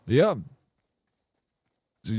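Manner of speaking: usual speaking voice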